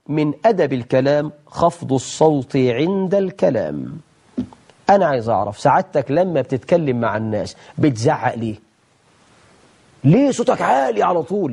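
usual speaking voice

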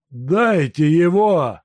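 slow, loud, high mad shout. A narrator's reading. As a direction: yell it from the bed. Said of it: "закричал больной".

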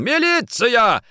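high, loud, slow drawn-out shout; yelling. Acted. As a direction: call it out long. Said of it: "закричал Никитин". (протяжный крик)

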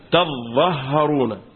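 slow تظهرون with doubled za and doubled ha. Shown as a demonstration. Teacher showing a third reading, تظهرون without alif, consonants doubled. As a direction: hold both consonants in تظهرون: taz-zah-HA-roo-na. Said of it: "بحذف الايه؟".

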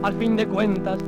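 usual speaking voice

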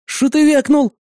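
high-pitched menacing challenge; menacing growl. A narrator's reading. Gunman holding revolver to engineer's head, menacing. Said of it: "проурчал я".

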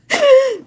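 real high squeal of laughter; bursting giggle. Real, not acted. Storyteller laughing over the misspoken rank, high giggle. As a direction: crack up briefly at yourself.

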